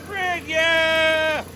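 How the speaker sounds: high, thrilled whoop of joy; excited cheer. Real, not acted. Man cheering as his old engine starts, high excited whoop. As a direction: squeal it out as the engine fires.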